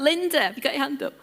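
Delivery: normal talking voice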